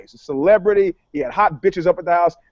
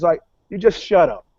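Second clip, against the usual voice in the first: usual speaking voice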